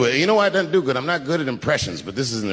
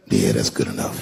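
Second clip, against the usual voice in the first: much lower, smug and dry; deep shrug to the crowd; deep self-satisfied mutter; 3 dB louder than usual. Retold or imitated. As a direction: shrug it off in a low voice. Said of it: "I'll be on stage like".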